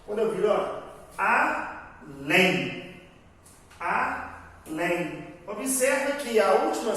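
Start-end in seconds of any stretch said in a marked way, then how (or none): 1.2-3.0 ALÉM split, with a firm LÉM after a pause; slow A, gap, emphatic final syllable in ALÉM; stressed syllable demo. Shown as a demonstration. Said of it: "é forte". 3.8-5.3 ALÉM in two slow beats, weight on LÉM; stressed syllable demo. Shown as a demonstration. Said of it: "Ela é forte".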